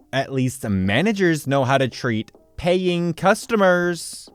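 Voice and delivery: ordinary delivery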